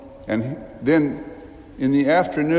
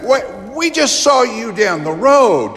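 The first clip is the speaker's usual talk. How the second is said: much higher and louder, incredulous; astonished disciples; 11 semitones higher, 5 dB louder than usual. Acted. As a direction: cry out in disbelief. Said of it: "I can imagine these two from Emmaus saying".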